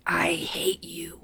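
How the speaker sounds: a possessed woman hissing in a man's whisper; possessed male voice. Retold or imitated. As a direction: whisper it like a demon speaking through her. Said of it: "in, like, this male voice".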